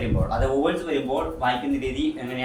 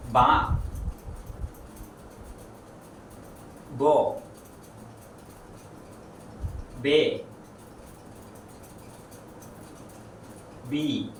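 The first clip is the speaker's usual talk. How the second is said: slow syllable drill; 6 dB quieter, much slower than usual. Shown as a demonstration. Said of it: "ആ പുള്ളി വന്നാല്".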